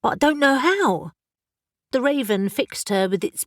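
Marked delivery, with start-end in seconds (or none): 0.0-1.1 excited. Acted. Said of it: "Elle was suddenly excited".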